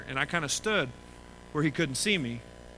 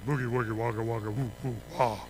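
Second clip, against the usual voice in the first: low, playful robot-bird gibberish; sing-song nonsense from the talking totem; lightly voiced totem pole; 6 semitones lower, 4 dB quieter than usual. Dad imitating the talking tiki totem pole. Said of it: "he's going".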